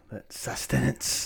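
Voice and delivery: slow, hushed whisper. Acted. Cohost whispering about the show as if it were a drug. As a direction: savour the word like a drug. Mock craving for new episodes.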